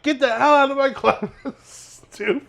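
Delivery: high, drawn-out mock outrage; mad teacher. Teacher kicking the prankster out of class, mock angry. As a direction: imitate an exasperated teacher throwing a student out. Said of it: "and he got mad".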